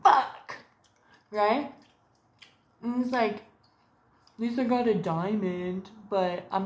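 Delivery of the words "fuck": exclamation. Real, not acted.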